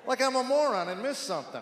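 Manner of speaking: normal talking voice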